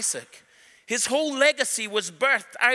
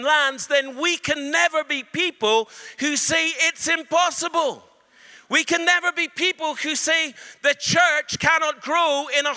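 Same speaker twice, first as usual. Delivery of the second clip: higher, louder driving preaching; preaching at full force; 7 semitones higher, 4 dB louder than usual. Acted. Preacher rallies the congregation, loud and high. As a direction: build the rally with fire.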